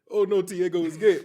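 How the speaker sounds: high, loud cry of dismay; mock horrified parent. Parent discovering news about a son, mock horrified. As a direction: wail it like a scandalized parent, half laughing.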